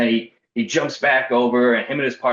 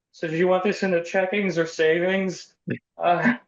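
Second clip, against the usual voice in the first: much higher, bright service voice hiding tears; chirpy bank teller fighting back tears; forced teller voice; 9 semitones higher than usual. Retold or imitated. Shaken teller to customer, forced cheery service voice. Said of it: "Just, like, holding back tears".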